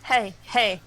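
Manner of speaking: normal talking voice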